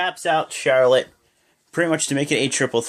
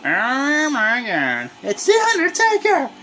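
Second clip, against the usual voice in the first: much higher, thrilled shouting; excited fan shout; 12 semitones higher than usual. Real, not acted. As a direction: scream with delight as the Undertaker appears. Undertaker appears on screen.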